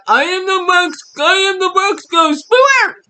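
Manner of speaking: high shouted cartoon ghost voice; Box Ghost impression. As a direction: boom it out like a silly ghost. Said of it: "That's all you get".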